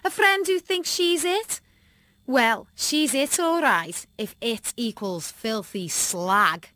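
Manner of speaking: sneering, slow mocking schoolgirl; mean girl Kim. A narrator's reading. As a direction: drawl out the insult, taunting.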